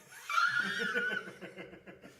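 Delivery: a high squealing burst of laughter; high shrieking laugh. Real, not acted. Friend cracking up at the joke, shrieking laugh.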